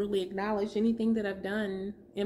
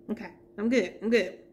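usual speaking voice

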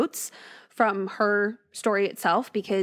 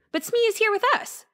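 much higher, insistent protest; arguing; 12 semitones higher than usual. A narrator's reading. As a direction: argue it, pitch climbing high. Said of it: "Jackson argued".

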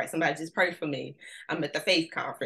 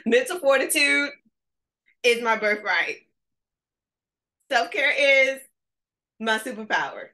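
louder, higher, laughing affirmation; with your chest; 6 semitones higher, 7 dB louder than usual. Shown as a demonstration. Coach demonstrating the affirmation again, fuller and laughing. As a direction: belt it from the chest. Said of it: "say it with your chest".

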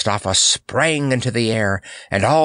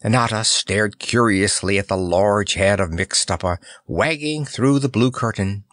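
usual speaking voice

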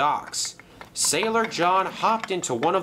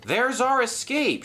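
high, urgent cry; shouting; 11 semitones higher than usual. Acted. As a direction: call out to the others excitedly. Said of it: "shouted Thomas".

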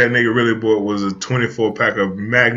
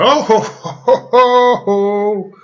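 mock laughter; 8 semitones higher, much slower than usual. Reacting to the married man, high mock laughing. Exaggerated laugh in acted exchange.

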